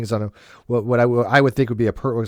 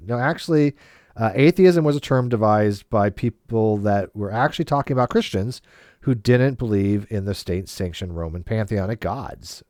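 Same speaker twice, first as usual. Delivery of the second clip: usual speaking voice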